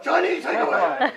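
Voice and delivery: loud, high-pitched hammed-up Cantonese accent; mock Cantonese accent. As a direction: call it out in a pretend Cantonese accent. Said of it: "in your best Cantonese accent".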